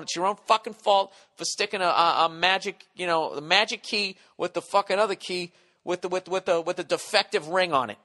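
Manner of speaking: indignant rant. Retold or imitated. Telling off the rental car clerk, loud indignant rant. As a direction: vent it like you're done with everyone.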